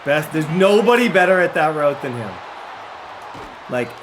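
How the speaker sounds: loud excited praise; excited shout. Real, not acted.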